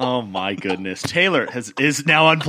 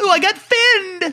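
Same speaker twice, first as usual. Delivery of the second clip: very high, slow, squealing whine; squeal, disgusted by the fish; squeamish high voice; 4 dB louder, slower than usual. Retold or imitated.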